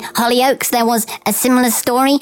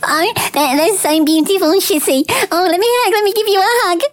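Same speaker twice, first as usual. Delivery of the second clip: gushing comfort; 8 semitones higher than usual. Acted. Consoling friend after poem.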